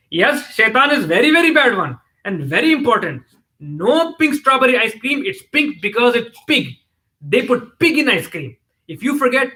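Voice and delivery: high-pitched Desi grandmother; Desi accent. A narrator's reading. Grandmother interrupts the children in the car, emphatic Desi accent. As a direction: blurt it out in a comic Desi accent. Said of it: "blurted out in her amazing Desi accent".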